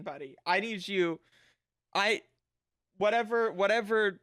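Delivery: ordinary talk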